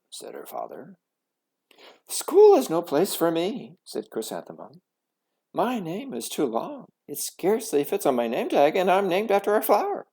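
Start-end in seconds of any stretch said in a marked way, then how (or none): 2.1-10.1 loud, high, fast lament; upset complaint. A narrator's reading. Girl complaining to her parents about school, upset. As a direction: pour out the hurt.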